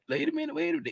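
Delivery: high, quick wait a minute; sudden catch. Real, not acted. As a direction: stop yourself mid-sentence. Catches own mistake.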